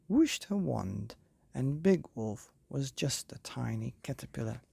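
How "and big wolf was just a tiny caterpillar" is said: ordinary delivery, nothing marked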